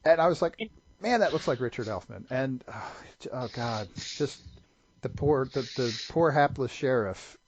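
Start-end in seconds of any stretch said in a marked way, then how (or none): none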